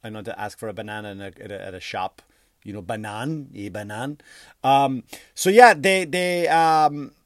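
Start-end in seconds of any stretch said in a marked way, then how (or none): 2.8-4.2 schoolboy French. Shown as a demonstration. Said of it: "I did take French".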